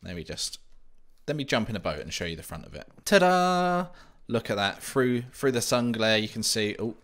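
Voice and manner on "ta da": high, loud showman's ta-da; ta-da reveal. Pretend. Showing the finished boathouse to viewers with a loud ta-da. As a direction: present it like a magic trick.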